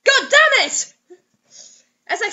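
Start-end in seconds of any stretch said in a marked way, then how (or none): 0.0-0.9 higher, louder hammed-up panic; mock panic. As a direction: overact the fear for laughs.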